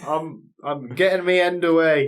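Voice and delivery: high, loud cheeky dog voice; busy dog. Pretend. Voicing the dog brushing off handler, loud and cheeky. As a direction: shoo off the handler, mid-hump.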